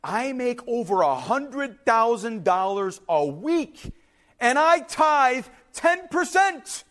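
slow, high, word by word; emphatic declaration. Retold or imitated. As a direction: spell it out for someone not listening. Castaway's final exasperated declaration.

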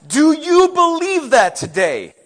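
loud, high, slow question; pressing challenge. Real, not acted. Preacher asks congregation to answer, pressing. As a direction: throw the question out forcefully.

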